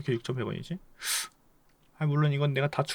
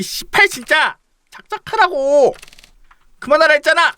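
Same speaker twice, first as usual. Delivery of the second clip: high, loud furious outburst at a friend; angry yelling; 13 semitones higher, 12 dB louder than usual. Acted. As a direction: snap and yell, fed up. Acted quarrel with friend Chanwoo. (화나서 높고 크게 소리치기)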